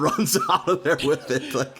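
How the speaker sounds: ordinary talk, nothing marked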